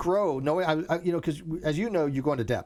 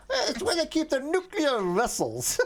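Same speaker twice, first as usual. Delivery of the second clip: put-on higher, slow Russian accent quoting Star Trek; Chekhov's Russian accent; 12 semitones higher, slower than usual. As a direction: imitate Chekhov asking the policeman, thick Russian accent. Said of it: "Chekhov was just talking to the policeman".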